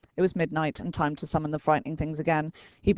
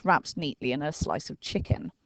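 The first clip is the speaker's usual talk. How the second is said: ordinary delivery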